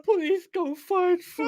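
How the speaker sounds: very high, slow, whiny begging; mock victim plea. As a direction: plead like a hammed-up crybaby. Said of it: "they was like".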